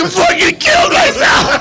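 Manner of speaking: very high, slow, shouted wail. Retold or imitated. Man out of his car by the exit wailing. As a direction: cry it out in mock despair. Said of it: "And I'm like".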